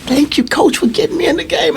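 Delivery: put-on a boy thanking his coach mid-game; grateful boy. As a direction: thank the coach like a beaming kid.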